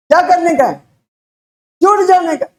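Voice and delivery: climbing high, answering its own question; rising excited preaching. Real, not acted.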